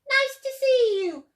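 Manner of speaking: high, loud hooting call; hooted greeting. A narrator's reading. Duckbills welcoming Drip, high loud hoot. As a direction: hoot a big hello. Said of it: "and they hooted".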